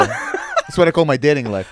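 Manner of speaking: ordinary talk, nothing marked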